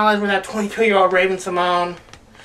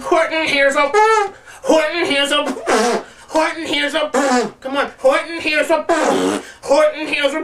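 mock stuck repetition; 7 semitones higher than usual. Pretending to forget the title, higher strained repeats. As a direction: keep failing to find the last word. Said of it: "It's like".

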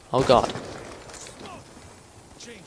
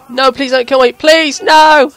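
higher, louder mock begging for mercy; mock pleading; 12 semitones higher, 10 dB louder, slower than usual. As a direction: ham up a panicked plea not to be killed. Mock begging enemy for life.

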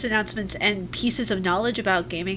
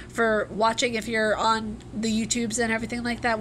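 ordinary delivery

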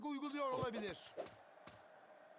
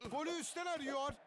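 ordinary talk

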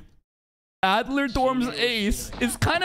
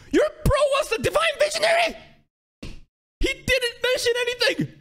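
excited shout. Real, not acted. Excited realization while watching.

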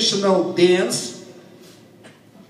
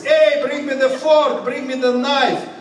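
higher-pitched, lively call; calling to friends; 8 semitones higher than usual. Acted. Cossack asking his friends for cutlery, higher-pitched calling out. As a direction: call out an urgent demand to your mates. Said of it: "he's asking his Cossack friends".

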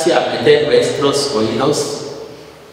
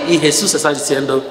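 ordinary talk

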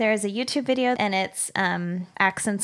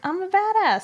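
sassy quote; 9 semitones higher than usual. Retold or imitated. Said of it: "she's like".

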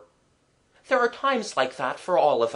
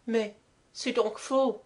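higher, choked-up French governess; choky voice; 9 semitones higher than usual. A narrator's reading. Governess finding her long-lost friend, choky. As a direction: say it through a choked throat. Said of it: "in a funny, choky voice".

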